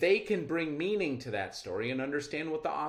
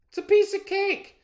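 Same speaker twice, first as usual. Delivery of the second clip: much higher and louder, yelling above the roar; shouting over an engine; 10 dB louder than usual. Acted. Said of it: "Gene shouted over the engine".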